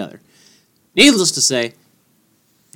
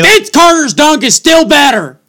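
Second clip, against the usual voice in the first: high, loud stubborn insistence; insisting loudly; 12 semitones higher, 8 dB louder than usual. Real, not acted. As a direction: shout down the other guy. Heated sports argument, insisting.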